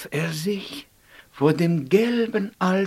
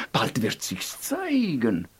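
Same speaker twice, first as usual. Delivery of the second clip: soft prophecy; 6 semitones lower, 5 dB quieter than usual. Acted. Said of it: "sagten die Feen".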